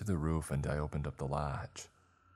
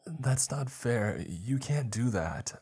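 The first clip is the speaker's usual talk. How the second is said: higher, slow, trembling near-sob; shaky crying voice; 7 semitones higher, slower than usual. A narrator's reading. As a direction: protest while on the verge of tears. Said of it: "I said in a shaky, crying voice".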